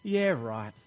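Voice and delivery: low, dry "yeah right"; skeptical scoff. Retold or imitated. Skeptic doubting winged angels, low dismissive scoff. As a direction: scoff like you don't buy it. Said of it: "I kinda go".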